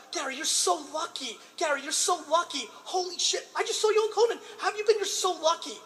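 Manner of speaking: high, giddy repeated praise; giddy high school friends, mock-gushing; imitating gushing friends. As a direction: gush like awed classmates, with a laugh. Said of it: "every second one".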